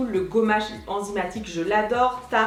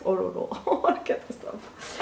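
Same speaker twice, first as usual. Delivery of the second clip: laughing; 4 semitones lower, 6 dB quieter than usual. Real, not acted. Se voit avec sa serviette.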